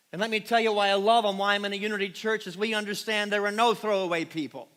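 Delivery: loud, rising sermon voice; full-force preaching. Acted. Pastor rousing the congregation on Palm Sunday, full-force preaching.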